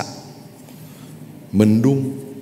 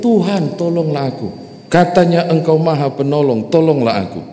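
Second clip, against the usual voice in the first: high, louder desperate plea; crying out for help; 7 semitones higher, 4 dB louder than usual. Acted. Preacher acting the stranded climber, a high desperate cry. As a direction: call upward to God, begging. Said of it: "Tolong, teriak".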